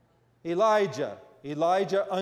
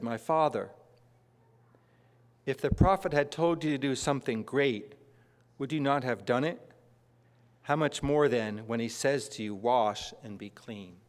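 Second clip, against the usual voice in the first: gentle servant; 5 semitones lower than usual. Acted. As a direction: ease him toward it like a loyal servant. Servant gently reasons with master.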